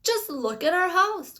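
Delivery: high, loud dismayed cry. A narrator's reading. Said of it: "cried Mister Bucket".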